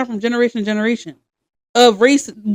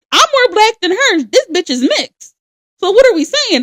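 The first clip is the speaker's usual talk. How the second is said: heated retort; 9 semitones higher, 3 dB louder than usual. Retold or imitated. Retelling her heated comeback.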